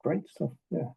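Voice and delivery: mock politeness with no real interest; fake polite agreement. Mentor mimicking meeting nodders, softly fake polite. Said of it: "they're just going".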